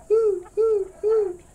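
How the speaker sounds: high, airy owl hoots. Pretend. Quiz on owl sound.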